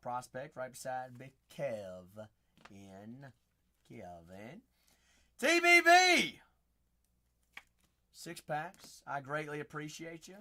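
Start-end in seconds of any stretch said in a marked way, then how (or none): 5.4-6.4 high, loud stretched shout of the name; shouted callout. Acted. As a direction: yell it across the room. Calling out to a viewer.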